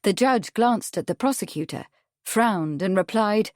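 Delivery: ordinary delivery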